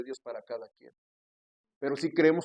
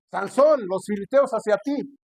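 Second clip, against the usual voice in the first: urgent call; 6 semitones higher, 6 dB louder, slower than usual. Acted. Dalila warns Samson.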